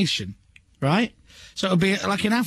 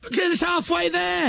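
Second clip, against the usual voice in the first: exasperated shout; 9 semitones higher than usual. Real, not acted. Exasperated explanation, shout heard.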